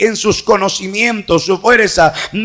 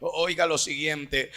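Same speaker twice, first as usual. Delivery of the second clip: low, soft, restrained sermon voice; hushed preaching; 5 semitones lower, 6 dB quieter than usual. Real, not acted. Preacher explains passing miracles to congregation, low and subdued. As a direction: drop right down after the climax and explain quietly.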